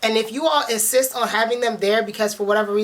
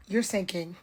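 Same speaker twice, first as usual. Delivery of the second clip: ordinary talk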